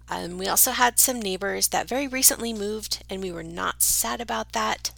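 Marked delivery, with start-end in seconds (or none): none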